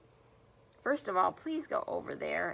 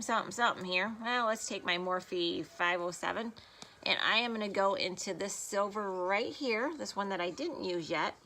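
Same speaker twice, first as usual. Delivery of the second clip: normal talking voice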